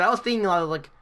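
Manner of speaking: ordinary talk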